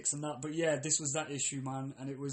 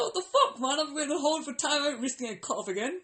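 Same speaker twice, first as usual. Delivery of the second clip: much higher, louder exasperated rant; laughing mock rage; 9 semitones higher, 3 dB louder than usual. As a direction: blow up half-laughing. Retold outburst at call centre.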